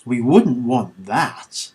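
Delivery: deadpan sarcastic line to the captors. Acted. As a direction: say it dripping with sarcasm. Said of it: "insincere and sarcastic".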